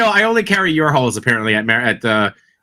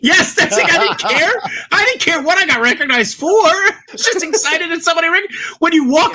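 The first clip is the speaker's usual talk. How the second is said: excited. Real, not acted. Thrilled at being recognized.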